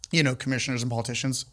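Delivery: ordinary delivery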